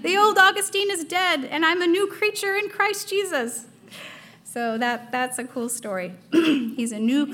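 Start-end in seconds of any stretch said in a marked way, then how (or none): 0.0-3.7 Augustine's reply. Retold or imitated. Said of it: "he turned around and said".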